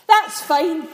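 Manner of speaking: a startled angel yelping back; yelped. Acted. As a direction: blurt it out sharply. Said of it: "he yelped".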